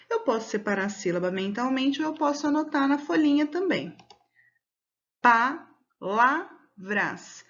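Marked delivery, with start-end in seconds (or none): 5.2-7.4 PALAVRAS very slowly, broken into pa, la, vras with clear pauses; syllable by syllable. Shown as a demonstration. Teacher splitting "Palavras" into syllables for pupils, slow and deliberate. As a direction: separate each syllable of "Palavras" with a long gap. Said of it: "pronuncio as sílabas pausadamente".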